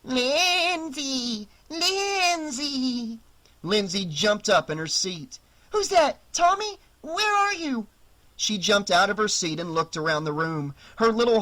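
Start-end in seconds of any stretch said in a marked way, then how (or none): 0.0-3.2 high, eerie calling voice; spooky voice. Acted. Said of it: "a spooky voice called". 5.7-7.9 put-on high, startled child voice; a scared girl calling out; frightened little girl. Startled girl hearing spooky voice.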